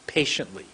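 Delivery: a soft, deliberate "Patiently"; lengthened word. Shown as a demonstration. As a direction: set the lips, then stretch "Patiently". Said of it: "I went like this".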